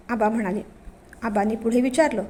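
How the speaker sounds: ordinary talk, nothing marked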